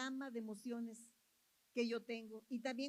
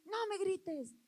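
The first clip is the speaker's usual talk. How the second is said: raised, higher-pitched mock yell; yelling back; 7 semitones higher, 6 dB louder than usual. Reacting to someone who yells at us, mock yell back. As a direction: snap back loudly in imitation of a shouter. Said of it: "cuando nos grita alguien".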